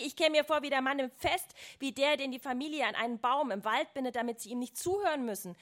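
heated narrated rant; angry shouting, read aloud. A narrator's reading. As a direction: keep shouting, easing slightly toward the end. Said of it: "Ich schrie".

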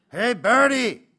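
put-on high, loud drunken call; boozy holler across the bar; drunken shout. A narrator's reading. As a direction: bellow for service like a drunk. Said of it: "rief einer von ihnen besoffen".

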